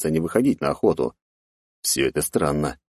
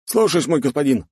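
higher military acknowledgement; saluting knight; 9 semitones higher than usual. A narrator's reading. Knight salutes the count, higher dutiful reply. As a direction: accept the order like a soldier. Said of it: "отдал воинское приветствие мужчина".

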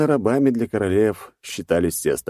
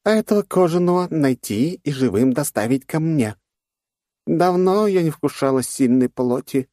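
insect queen voice; 10 semitones higher than usual. Acted. Queen orders capture of human.

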